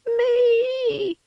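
tiny very high squeak. A narrator's reading. Kitten joins the call, squeaking very high.

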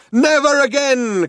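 high, drawn-out rallying cry. Acted. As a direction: declare it to a whole square. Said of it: "cried the man".

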